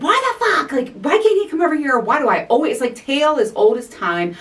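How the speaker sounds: high, loud, frustrated outburst to a friend; retelling a rant to Katina, exasperated and loud. Retold or imitated. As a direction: let the overwhelm burst out. Venting on the phone to Katina.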